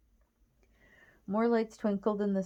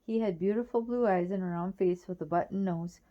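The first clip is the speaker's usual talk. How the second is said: plain reading voice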